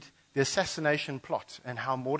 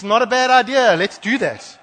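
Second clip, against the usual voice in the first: gleeful villain; 10 semitones higher, 13 dB louder than usual. Acted. Haman gleefully accepts plot.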